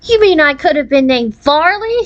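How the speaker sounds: a mild shriek of disbelief; mock shriek. A narrator's reading. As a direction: pitch jumps up in horrified disbelief. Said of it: "shrieked Faustine".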